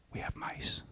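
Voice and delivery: wife's scared whisper over the phone; retold whisper. Wife whispering on the phone about a problem, hushed. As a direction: hush every word like someone might overhear. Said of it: "why are you whispering?".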